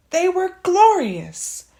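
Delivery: high, loud and gushing; rapturous gush; gushing delight. A narrator's reading. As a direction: swoon over them. Gushing over underwear.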